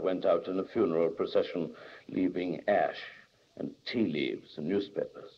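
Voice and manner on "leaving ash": ordinary delivery, nothing marked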